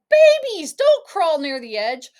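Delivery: very high, loud alarmed call; crying out. Acted. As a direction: shout before they fall off the ledge. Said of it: "you cried".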